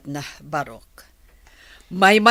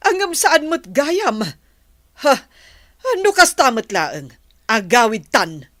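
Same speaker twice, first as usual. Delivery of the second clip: put-on raised, sharp scolding of a lazy child; angry scolding; 5 semitones higher, 6 dB louder than usual. Mother scolds daughter for skipping school, angry and loud. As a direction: tell her off loudly.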